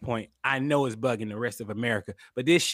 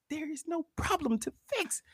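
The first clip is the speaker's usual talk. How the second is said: high, hushed, held-back reply; strained restraint; 14 semitones higher, 7 dB quieter than usual. Retold or imitated. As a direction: imitate keeping your temper on a tight leash. Retold reply to complaint, strained restraint.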